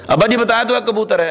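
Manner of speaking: irritated son snapping back; bitter, harsh tone. Retold or imitated. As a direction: snap at the old father, tired of the question. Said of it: "تو تھوڑا سا لہجہ تلخ ہو گیا".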